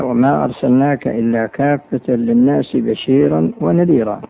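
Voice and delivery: usual speaking voice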